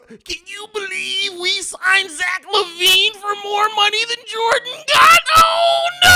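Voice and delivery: high mock wail, swelling to a shout; annoying Twitter fan. Bulls fan outraged over a contract, mock wailing. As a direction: start whining high, end in a long cried-out howl. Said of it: "that is the voice of the, of the annoying Twitter Bulls fans".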